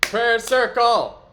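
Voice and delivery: loud, slow rallying cry; excited shout. Pretend. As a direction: call it out to the table. Joining a prayer circle call.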